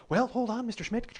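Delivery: lightly voiced woman at the airline counter; retold agent asking him to wait; gate agent.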